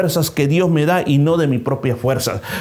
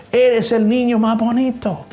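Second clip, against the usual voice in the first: put-on higher, drawn-out echo of the boy; the echo repeats the boy, slowly; 8 semitones higher than usual. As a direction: let it ring back from the rocks. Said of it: "Y la voz dijo".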